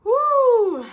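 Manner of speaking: loud high woo; joyful whoop. Real, not acted. Cheering over the verse she just read, whooping. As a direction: let out a big whoop of joy. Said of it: "That gets me going".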